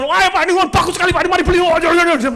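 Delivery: high, loud, breakneck sales pitch; over-eager salesman. Retold or imitated. Salesman urging a customer to buy, over-excited. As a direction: gush excitedly to push the purchase. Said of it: "Rata-rata sales itu semangat".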